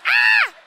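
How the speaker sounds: very high, loud scream; shriek. Retold or imitated. Mom left alone with bed bugs, piercing scream. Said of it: "I was like".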